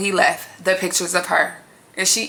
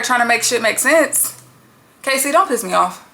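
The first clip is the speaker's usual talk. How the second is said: ordinary talk, nothing marked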